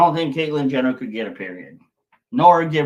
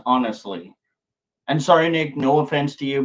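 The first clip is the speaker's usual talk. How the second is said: usual speaking voice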